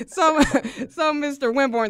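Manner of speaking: high and loud, through laughter; laughing while talking. Real, not acted. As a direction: talk while still laughing. Host laughing while starting intro.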